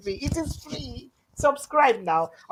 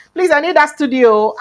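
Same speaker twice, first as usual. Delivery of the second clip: much higher, louder eager plea; excited plea; 9 semitones higher, 12 dB louder than usual. Real, not acted. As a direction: beg for the studio with real excitement. Pleading excitedly for a studio.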